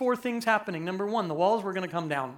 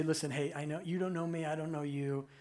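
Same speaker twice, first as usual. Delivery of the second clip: much softer and lower, leaning in close; hushed urging; 6 semitones lower, 10 dB quieter than usual. Retold or imitated. Time traveller softly urges weary Israelite mother onward. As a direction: quietly coax a stranger to keep going. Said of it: "you say".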